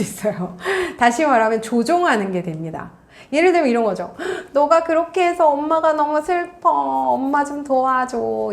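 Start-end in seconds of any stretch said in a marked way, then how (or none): 4.5-8.5 hammed-up plaintive mother, drawn out; high mock-sad wheedling that slows down; pitiful guilt-trip. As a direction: plead for pity, stretching the words. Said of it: "불쌍한 마음을 자극한다든지".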